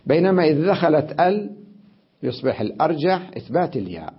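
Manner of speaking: ordinary talk, nothing marked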